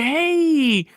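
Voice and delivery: high, slow smiling hello; soft smile greeting. Shown as a demonstration. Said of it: "That is a soft smile".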